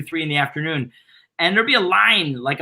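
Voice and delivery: ordinary talk, nothing marked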